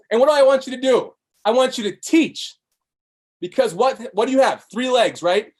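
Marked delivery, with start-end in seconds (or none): none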